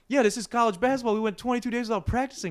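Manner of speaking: high, loud mocking echo of the coach; mocking retort. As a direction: throw his words back at him, loud and high. Jake mocking coach back, retold.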